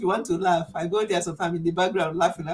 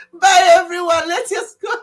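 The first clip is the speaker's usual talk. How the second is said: high squealing laugh through the goodbye; cracking up so hard the voice goes up; 11 semitones higher, 10 dB louder than usual. Real, not acted. Signing off to viewers while laughing helplessly.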